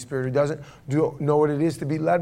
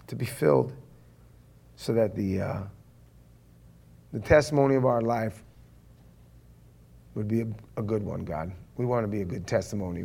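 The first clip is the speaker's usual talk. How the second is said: opening prayer; 4 semitones lower than usual. Real, not acted. Pastor asking God to show the church, hushed prayer.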